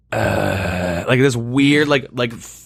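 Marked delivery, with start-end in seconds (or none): 0.1-1.0 long, low breath noise into the mic; imitated heavy breathing. Mimicking the weird guy on Discord, heavy mic breathing. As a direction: breathe heavily like the stranger on the call. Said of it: "he was just breathing into the".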